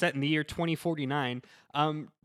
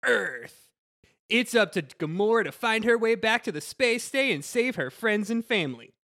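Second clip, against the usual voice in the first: higher, bouncy Disney trailer announcer; Disney trailer guy; 6 semitones higher than usual. Acted. Friend reading back of the VHS box on request, Disney trailer guy voice. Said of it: "your best Disney trailer guy voice".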